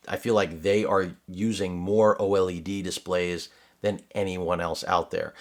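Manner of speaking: ordinary talk, nothing marked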